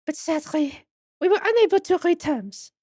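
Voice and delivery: put-on high French-accented regret; French museum madam. Madam reporting failed terms, high French accent.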